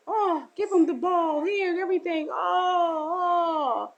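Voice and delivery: joyful welcome. Acted. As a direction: gush with joy over the returned son.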